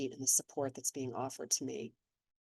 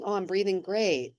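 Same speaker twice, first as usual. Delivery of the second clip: a chirpy, higher and louder little boast; pleased inner voice; 4 semitones higher, 7 dB louder than usual. Pretend. Inner voice praising its own breathing, mock cheerful. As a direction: voice the self-satisfied thought brightly. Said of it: "heard the voice saying".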